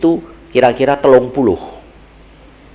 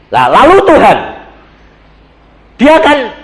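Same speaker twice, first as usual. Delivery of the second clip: ordinary talk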